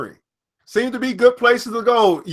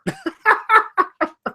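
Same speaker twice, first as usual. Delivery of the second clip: high cackling laugh; 11 semitones higher than usual. Real, not acted. Vlogger laughing at his Trump quote, high cackle. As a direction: crack up high.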